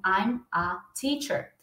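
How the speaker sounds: I'm a teacher slow, each word apart; slow separated version. Shown as a demonstration. Teacher showing students the stiff separated way, slow. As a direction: say I'm a teacher slowly with a gap after I'm. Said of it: "dificilmente alguém vai chegar e vai falar assim".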